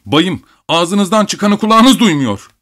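high, slow, screechy protest; piercing indignant cry; shrill shout. A narrator's reading. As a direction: pitch it up shrilly, drawing it out. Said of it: "Abogin cırlak bir sesle".

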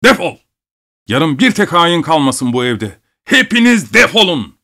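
high, explosive dismissal; enraged order to get out; furious shout. A narrator's reading. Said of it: "arkasından bağırdı".

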